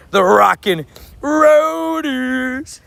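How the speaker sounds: high, slow, stretched-out showy call; hype call. Pretend. Streamer cheering about Chicago meetup, drawn-out hype call. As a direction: draw the name right out, pitched high.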